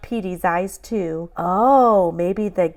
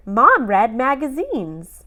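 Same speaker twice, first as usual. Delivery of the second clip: ordinary delivery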